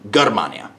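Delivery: "Germania" begun with g as in go; hard G. Shown as a demonstration. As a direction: repeat "Germania" with the Latin hard G. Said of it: "G is going to be a hard".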